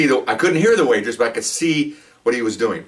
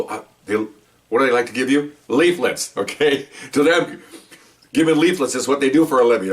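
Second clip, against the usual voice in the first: ordinary talk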